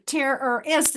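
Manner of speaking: "Terrorist" spaced out as TERR-ER-IST; three syllables. Shown as a demonstration. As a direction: slowly sound each syllable of "Terrorist". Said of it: "it's three".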